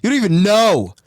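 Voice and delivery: heated. Real, not acted. Heated teasing argument.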